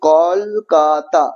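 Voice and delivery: কলকাতা slowly split as kol-ka-ta; syllable by syllable. Shown as a demonstration. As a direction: stretch out each syllable of কলকাতা. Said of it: "তিনটা দল".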